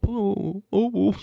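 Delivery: high mock moaning; mock lament. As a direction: wail "oh" in mock dismay. Mock lament in the same caricature.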